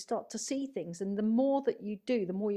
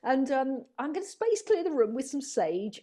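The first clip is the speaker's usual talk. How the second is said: bright, louder, bubbly sales-pitch voice that rushes along; breezy enthusiasm; 5 semitones higher, 5 dB louder than usual. Retold or imitated. Retold therapist offering a client Reiki and crystals, eager and chirpy. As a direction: gush about the healing you're about to offer. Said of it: "And it would be like".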